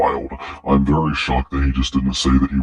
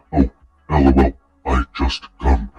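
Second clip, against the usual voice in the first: halting mock Neanderthal voice; mock him as a caveman, words broken apart. Commentator asking who would say this, caveman imitation. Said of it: "What, is he a caveman?".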